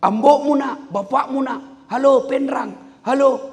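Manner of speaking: high, loud yelling into the phone; retold phone shouting. Rich old man yells at his son on the phone. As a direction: shout hello down a dead line.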